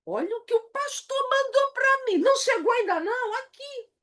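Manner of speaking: acted-out affront in a high voice; indignant misreading. Shown as a demonstration. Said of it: "a pessoa pode ler".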